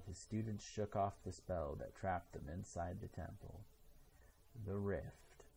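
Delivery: plain reading voice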